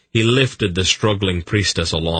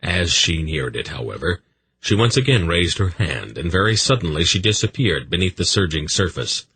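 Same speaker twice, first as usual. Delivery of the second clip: ordinary delivery, nothing marked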